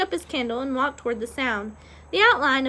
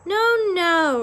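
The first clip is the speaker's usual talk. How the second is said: higher, louder exasperated groan; exasperated objection; 6 semitones higher, 5 dB louder than usual. A narrator's reading. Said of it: "Roscuro said with a groan".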